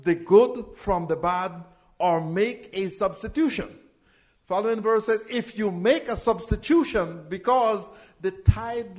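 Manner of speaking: loud, driving call-and-response preaching; full-force preaching. Acted. Pastor calling the tithe verses to his congregation, loud preaching.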